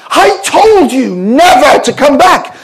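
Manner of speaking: high, slow, raging outburst; exploding in rage. Retold or imitated. Embassy woman explodes at returning missionary, slow raging voice. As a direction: explode at him in fury. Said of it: "she exploded in rage".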